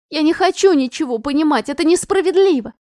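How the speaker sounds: high, loud tearful outburst; crying protest. Acted. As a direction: wail the unfairness.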